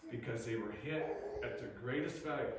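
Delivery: normal talking voice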